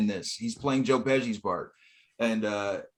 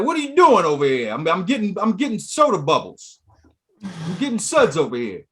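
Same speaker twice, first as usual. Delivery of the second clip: higher, louder mock wiseguy complaint; Lovitz's sketch wiseguy; 6 semitones higher, 6 dB louder than usual. Host quotes man getting brew poured, high indignant voice. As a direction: protest like a wiseguy in a skit. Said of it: "He's like".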